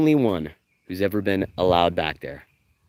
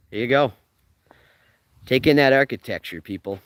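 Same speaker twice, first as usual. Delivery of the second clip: ordinary talk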